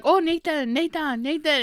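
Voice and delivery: high-pitched fawning over a man; mock adoring. Aunties besotted with Nathan, imitated adoringly. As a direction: gush his name like smitten aunties. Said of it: "in love with this man".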